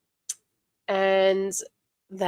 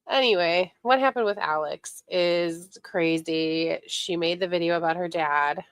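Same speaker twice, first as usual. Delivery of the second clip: usual speaking voice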